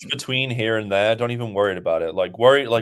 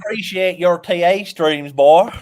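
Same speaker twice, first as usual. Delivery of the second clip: high, hammed-up Southern accent; mock Southern scolding, loud on the last word; 9 semitones higher, 5 dB louder than usual. Co-host joking back about streams, southern accent. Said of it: "your accent went straight southern".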